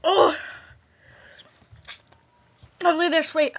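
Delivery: normal talking voice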